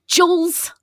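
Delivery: name flung out high and loud; announcing own name. Real, not acted. DM signing off the stream, announcing own name loudly. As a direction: land the name like a reveal.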